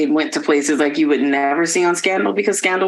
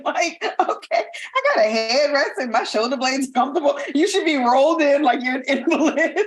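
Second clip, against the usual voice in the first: laughing mock; 7 semitones higher than usual. Mock comfy viewer, laughing.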